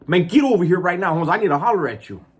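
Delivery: pushy, high-voiced call across a yard; calling someone over. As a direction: summon him over impatiently from a distance. Summoning Paul across the yard.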